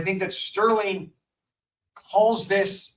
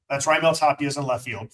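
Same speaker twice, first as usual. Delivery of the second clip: normal talking voice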